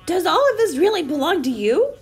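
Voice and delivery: high-pitched, wondering question; amazed question. A narrator's reading. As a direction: ask in wide-eyed wonder. Pete marvels at the food.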